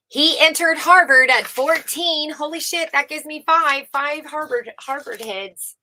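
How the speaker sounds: high, loud astonishment; amazed. Real, not acted. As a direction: blurt it out, amazed. Amazed reaction to age fourteen.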